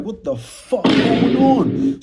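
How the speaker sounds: drawn-out, high "What the fuck going on?"; alarmed disbelief. Retold or imitated. To the gunmen at his door, slow and high, in alarm. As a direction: panic at the gun in his back. Retold panic at gunpoint.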